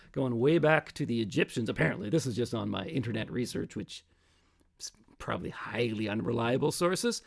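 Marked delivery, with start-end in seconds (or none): 2.1-7.2 a whispered self-mocking aside; whispered under the breath. Real, not acted. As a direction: drop to a whisper, as if confessing. Aside about internet research.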